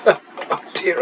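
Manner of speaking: rueful laugh. Real, not acted. Laughing at his mounting losses.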